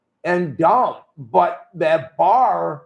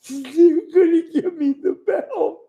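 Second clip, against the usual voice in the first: high, squealing laugh-cry mockery; giddy laughing boast. Wrestler mocks Bret gloating about getting the belt, squealing. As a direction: squeal with delight like a vain champ.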